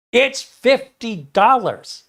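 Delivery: high, loud and slow, spelling it out. Retold or imitated. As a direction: drag it out slowly like talking to someone dense. Seller repeating price, more exasperated.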